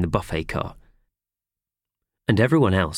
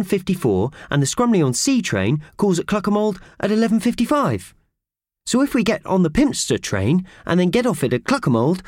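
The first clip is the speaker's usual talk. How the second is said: put-on high, keen boy's voice laying out the plan; a clever boy explaining the trains; eager young Purvis; 11 semitones higher than usual. A narrator's reading. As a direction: talk it through proudly, quickly in places.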